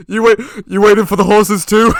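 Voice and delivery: loud excited question; acted quote. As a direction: blurt it out loud.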